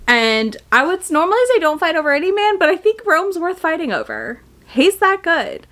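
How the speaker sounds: giddy fangirl praise; excited gushing. Real, not acted. Gushing about Rome.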